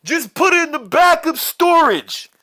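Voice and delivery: acted impatient call. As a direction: shout it across to a workmate. Coworkers call out to fats.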